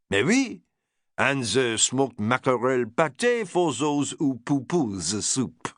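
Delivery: put-on strong French accent, proud and grand; French chef. French chef boasting his menu to Lord Errington, strong French accent.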